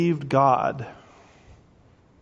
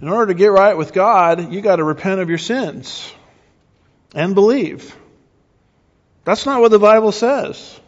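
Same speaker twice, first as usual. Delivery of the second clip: loud, higher-pitched preacher's insistence; emphatic preaching; 5 semitones higher, 6 dB louder than usual. Acted. Preacher correcting evangelists on repentance and belief, emphatic preaching. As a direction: preach it forcefully, punching the key words. Preaches against a false gospel.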